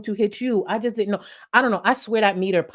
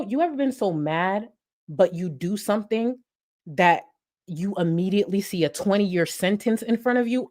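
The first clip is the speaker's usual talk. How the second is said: usual speaking voice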